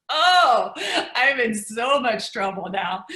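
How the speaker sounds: laughing mock dismay; loud laugh through the worry; giggling "I'm in so much trouble now". Retold panic, laughing.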